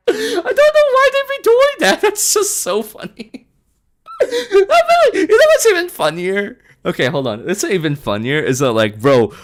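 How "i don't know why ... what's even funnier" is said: high squealing laughter through the words; real giggle fit, voice pitched way up; cracking up. Real, not acted. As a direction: talk while helplessly laughing. Cracking up over simps.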